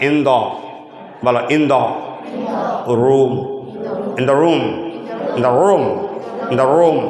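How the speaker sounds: "in the room" dropped low and repeated; falling on room. Shown as a demonstration. Teacher drilling the final phrase for students. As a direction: let "room" sink at the end. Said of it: "লাস্টে বলতে হবে".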